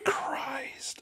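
breathy whispered aside; exasperated whisper. Real, not acted. A player whispering at his friend's silly bit. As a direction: whisper it in disbelief.

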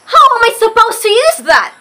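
high, fast, panicky question; worried. Retold or imitated. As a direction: blurt it out in a panic. Panicked kid asking aunt.

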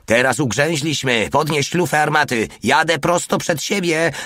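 high, urgent yelled orders; yelling. A narrator's reading. Driver orders the crew before ramming a wall, high acted yell. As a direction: call out commands pitched well up. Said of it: "darł się Porta".

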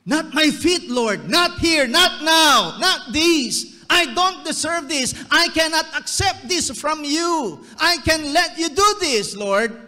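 high, loud, pleading cries of unworthiness; pleading protest. Acted. Peter protesting to Jesus, high loud pleading. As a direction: cry out that you're unworthy. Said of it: "Is this humility?".

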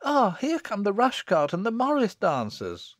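put-on high, excited girlish call; joyous cry. Girl at the window announcing dancers, high excited cry. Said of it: "rushing joyously to the window".

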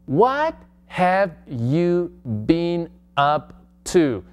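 very slow, deliberate teacher read; slow classroom demo; slow model question. Shown as a demonstration. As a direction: draw each word out for learners.

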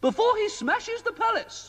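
high, fussy queenly voice; a worried queen pleading with the wizard. A narrator's reading. Queen complaining to Mister Ben about the king, high queenly voice.

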